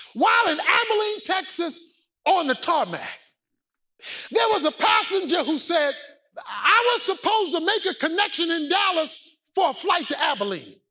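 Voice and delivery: high, shouted sermon climax; full-force preaching. Real, not acted.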